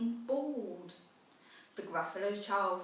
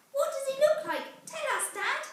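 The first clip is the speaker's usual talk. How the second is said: high, eager small-monster voice, a bit fast; a curious little monster pestering her dad; little Gruffalo child; 12 semitones higher, 4 dB louder than usual. Acted.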